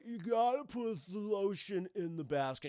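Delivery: lightly put-on creepy captor voice; a hint of a menacing kidnapper; Buffalo Bill. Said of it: "good old Buffalo Bill".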